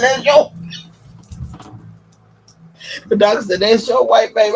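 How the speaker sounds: high, loud imitation of the doctor; loud mock doctor. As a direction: announce it to the mother loudly. Doctor's reply, retold.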